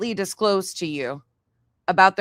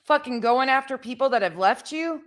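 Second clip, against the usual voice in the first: real angry rant, higher-pitched and heated; pissed off; 4 semitones higher than usual. Real, not acted. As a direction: chew them out in disbelief, swearing hard. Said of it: "Sorry, I'm pissed".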